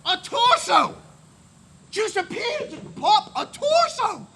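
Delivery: loud, high, amazed shout of a torso; excited shout. Real, not acted. Punchline, shouted torso reveal.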